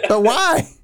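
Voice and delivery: high, puzzled "But why?"; baffled "but why". Retold or imitated. Asking Kate where his slang came from, high and baffled. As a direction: ask it in real bafflement. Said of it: "And I'm like".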